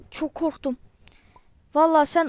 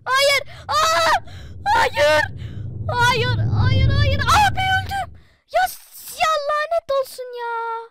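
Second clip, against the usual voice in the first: despairing scream; 11 semitones higher, slower than usual. Real, not acted. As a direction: scream as you die in the game. Oyunda ölürken çığlık atıyor. (çaresizce çığlık atarak)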